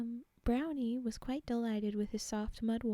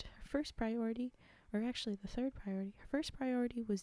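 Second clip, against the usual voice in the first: ordinary delivery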